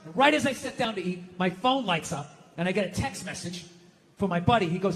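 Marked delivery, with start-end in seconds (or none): none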